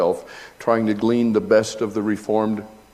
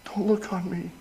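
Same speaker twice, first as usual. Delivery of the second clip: Peter pleading; 10 semitones higher, 4 dB quieter than usual. Acted. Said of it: "fell down on his knees".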